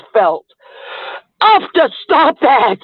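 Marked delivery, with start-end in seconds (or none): 1.4-2.8 playful shout. Real, not acted. Calling out to viewer.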